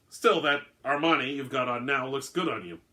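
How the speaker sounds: ordinary delivery, nothing marked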